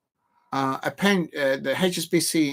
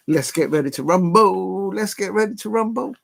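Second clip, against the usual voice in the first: lightly hammed announcer call, pitched up and loud at first; announcer catchphrase; 5 semitones higher, 4 dB louder than usual. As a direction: call it out like a hype announcer, rising.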